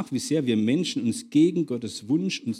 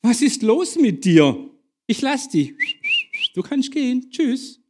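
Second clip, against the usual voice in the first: exasperated; 11 semitones higher, 4 dB louder than usual. A narrator's reading. Exasperated officer sends prisoner off.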